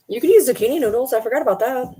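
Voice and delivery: ordinary talk, nothing marked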